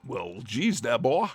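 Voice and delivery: half-hearted American Southern drawl; a lightly attempted Southern accent. Streamer trying what chat expected, weak Southern accent. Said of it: "smoky lung American Southern accent".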